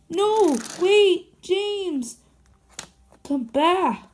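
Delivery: high, loud call to stop him; calling after. Acted. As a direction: shout after him not to leave. Calling after a departing engine.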